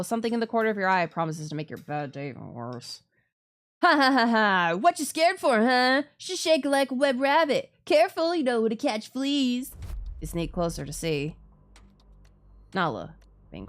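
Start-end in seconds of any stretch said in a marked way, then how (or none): 3.8-9.7 loud, high jeering bully laugh and taunt; mocking bully. Bully Bran taunting the scared girl, jeering loudly. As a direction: cackle at her like a schoolyard bully.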